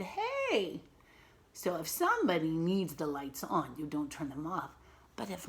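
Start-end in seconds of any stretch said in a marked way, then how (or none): 0.0-0.8 dad's protest. Acted. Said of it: "her dad said".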